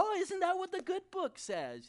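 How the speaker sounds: high, loud know-it-all parody; mock naive. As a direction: imitate someone naively quoting folk wisdom. Mocking made-up Bible sayings.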